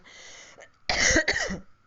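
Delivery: sharp real cough; hacking cough between words; coughing fit. Real, not acted. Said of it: "maaf, ini batuk".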